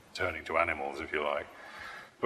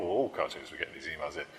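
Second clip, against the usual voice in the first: normal talking voice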